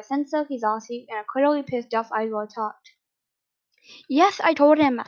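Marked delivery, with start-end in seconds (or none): 4.1-5.0 loud, higher blurted admission. A narrator's reading. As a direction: blurt the confession out. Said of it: "Senso blurted out".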